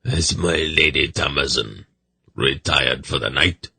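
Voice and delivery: put-on grave, measured old retainer; sombre, formal raspy voice; grim seneschal. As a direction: ask stiffly in a rasping servant's voice. Seneschal's rasping voice.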